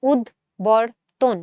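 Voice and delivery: উদ্বর্তন very slowly in pieces, "ud, bor, ton"; b sounded out. Shown as a demonstration. Teacher proving the b is pronounced, slowly sounded out. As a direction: break up উদ্বর্তন slowly to expose the b. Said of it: "এখানে কিন্তু বয়ের উচ্চারণ আছে".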